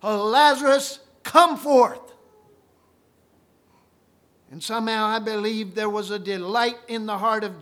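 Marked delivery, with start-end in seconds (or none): 0.0-2.1 loud, high call into the tomb. Acted. Preacher voicing Jesus calling Lazarus from the tomb, loud high call. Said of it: "with a loud voice".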